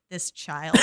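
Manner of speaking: deadpan Daphne. Retold or imitated. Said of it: "Daphne's like".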